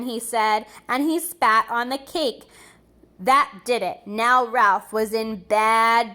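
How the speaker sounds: put-on lively read-aloud for children; storybook reading. A narrator's reading. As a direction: read it like a bedtime picture book. Reading a picture book aloud.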